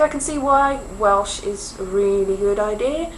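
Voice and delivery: ordinary talk, nothing marked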